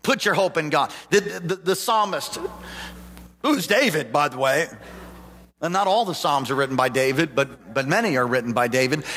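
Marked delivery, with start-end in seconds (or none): none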